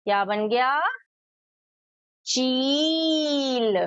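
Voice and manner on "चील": "चील" with a very long, stretched ee; drawn-out long ई. Shown as a demonstration. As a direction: stretch the vowel of "चील" slowly, gently arching. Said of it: "स्वर खींचकर".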